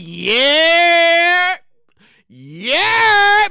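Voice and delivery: loud, stretched New York "yeah"; put-on New York debt collector; New York accent. Loan collectors come calling in January, in a New York accent. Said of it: "my New York accent".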